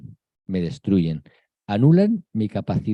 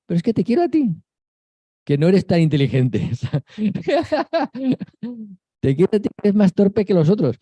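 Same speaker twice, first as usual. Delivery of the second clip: higher, tender voice broken by giggles; the Virgin speaking; 6 semitones higher than usual. Retold or imitated. The Virgin Mary telling a clumsy chosen one she wants him, high and laughing. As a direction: coax him kindly through little laughs. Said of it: "Y la Virgen nos diría".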